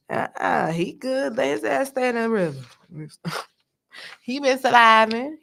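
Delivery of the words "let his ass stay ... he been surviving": normal talking voice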